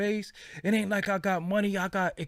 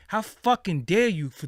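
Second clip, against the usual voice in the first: ordinary delivery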